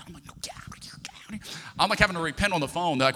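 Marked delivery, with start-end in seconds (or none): 0.3-1.6 whispered shooing, barely voiced; frantic hushed order; urgent whisper. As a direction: whisper it sharply to someone in the room. Shooing wife during phone call.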